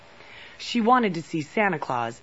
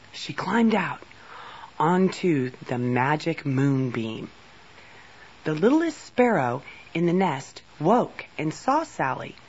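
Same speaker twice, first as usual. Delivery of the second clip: ordinary delivery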